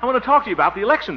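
high-pitched, insistent demand, rushing at the end; exasperated. Acted. Harold begging the doctor to listen about the election, exasperated. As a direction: plead urgently for his attention.

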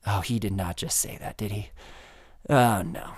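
softly, like handlers caught out; a hushed "oh no" from the back room; hushed panic. Retold or imitated.

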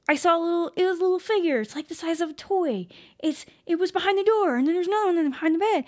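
high, loud and rushed; frantic retelling. Retold or imitated. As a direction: spill it out to the parents all at once. Kid frantically telling the parents.

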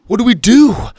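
loud, high desperate question; panicked shout. Acted. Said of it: "Dylan shouted".

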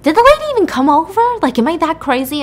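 high, loud confused exclaim; bewildered disbelief. Retold or imitated. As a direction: question her own sanity aloud. Doubting her own memory.

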